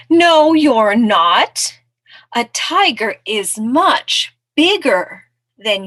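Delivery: loud, slow, emphatic rebuttal; storybook raccoon laying down the law; contradicting raccoon. Acted. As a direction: flatly contradict the mouse, stretching the words.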